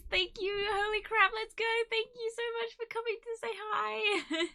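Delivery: very high, emotional thank-you; overwhelmed thanks. Real, not acted. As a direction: squeak out gratitude on the verge of tears. Thanking a group of arriving viewers.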